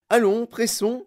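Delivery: high, loud hurry-up call; brisk cry. A narrator's reading. Inventor hurries the lingering visitors, crying out. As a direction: call out to hustle them along. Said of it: "cria monsieur Wonka".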